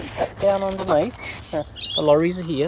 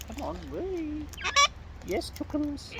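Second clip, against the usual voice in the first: high, soft coo to a bird; pet talk; 12 semitones higher, 9 dB quieter, slower than usual. Real, not acted. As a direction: coax the bird gently up high. Cooing to pet birds.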